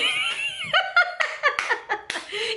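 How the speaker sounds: long high shrieking laughter. Real, not acted. Vlogger showing the photo to viewers, shrieking laugh. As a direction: howl with laughter.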